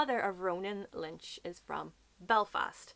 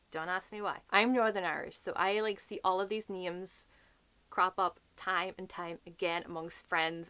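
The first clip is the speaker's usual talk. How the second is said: ordinary delivery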